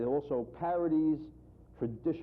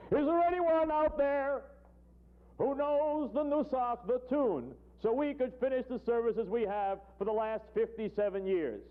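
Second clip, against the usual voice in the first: high, slow sing-song plea from the pulpit; high cantorial wail to the crowd; pleading rabbi; 11 semitones higher, 3 dB louder, slower than usual. Acted. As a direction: chant the question like a desperate cantor.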